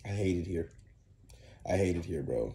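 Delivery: put-on mumbled low and quiet, a sulky kid's voice; mock sulky student. Co-host mocks a student's sulk, low and grumbling. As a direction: slouch and grumble it like a bored student.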